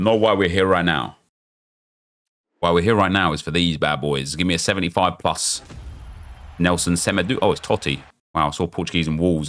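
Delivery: ordinary talk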